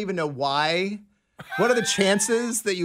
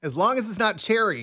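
louder and higher, repeating the warning; repeated louder; 6 semitones higher, 5 dB louder than usual. Real, not acted. Repeating the cherry warning to the coworker who missed it, louder. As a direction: say it again, louder, so she hears.

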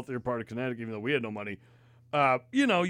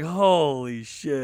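stunned disbelief; 5 dB louder, much slower than usual. Retold or imitated. Retelling his stunned head shake.

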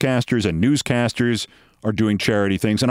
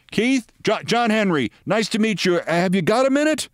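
much higher-pitched, eager introduction; club owner hailing someone; 8 semitones higher than usual. Retold or imitated. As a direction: call out a friendly greeting in a higher voice. Club owner calls over to reporter.

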